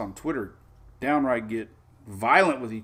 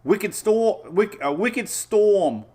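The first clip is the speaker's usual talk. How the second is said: higher, slow hammed-up Boston accent, savoring wicked; drawn-out Boston wicked storm, playful; 8 semitones higher, 6 dB louder, slower than usual. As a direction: tease a Cape Cod friend in a mock Boston accent. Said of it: "what do they call it up there?".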